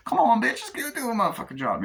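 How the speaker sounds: high-pitched nagging demand; bossy actor impression. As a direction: push him into the job. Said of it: "doing the impressions of the, uh, actors".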